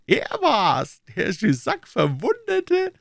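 slow, very high-pitched king; astonished royal cry. A narrator's reading. King hearing who wounded Jussac, astonished high cry. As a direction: exclaim slowly in high-voiced disbelief. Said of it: "rief der König".

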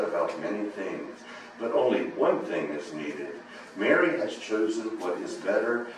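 low, soft, gentle reply; calm her down tenderly; Jesus gently answers Martha, low and soft. Acted. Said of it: "I believe very gently".

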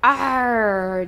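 loud, drawn-out pirate growl of approval; pirate cheer. A narrator's reading. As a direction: cheer like a storybook pirate captain. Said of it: "cheered the captain".

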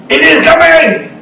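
high, loud preacher's proclamation; ringing high shout of faith; triumphant declaration. Acted. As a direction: call out the promise with full force. Acting God's promise amid trials.

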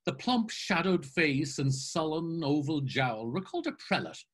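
ordinary delivery, nothing marked

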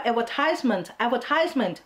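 "advertisement" as ad-ver-TIZE-ment; long i in the third syllable of "advertisement"; American "advertisement". Shown as a demonstration. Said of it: "advertisement คืออเมริกัน".

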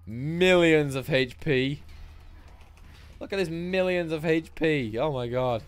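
mock outrage at the health pool; exasperated. Real, not acted. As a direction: drag it out in exasperation. Exasperated at huge boss HP.

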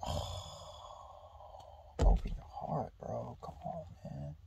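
breathy, hushed mock coaxing; hushing himself, teasing the book's advice; whispered scoff. Himself mocking the chapter, a hushed scoff. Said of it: "just being like".

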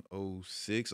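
normal talking voice

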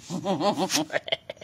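breathy amused laugh; laughing at the hair reveal. Real, not acted. Vlogger laughing at the hair she brought out.

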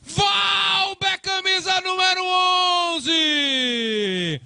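screaming the goal, high and loud; goal call. Real, not acted. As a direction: roar it out like a stadium announcer.

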